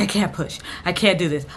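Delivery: normal talking voice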